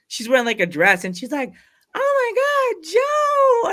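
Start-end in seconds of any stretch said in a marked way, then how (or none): 1.9-3.7 high, loud excited shriek of a name; excited squeal. Retold or imitated. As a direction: squeal it like a thrilled fan spotting a friend. Teal excitedly greets narrator.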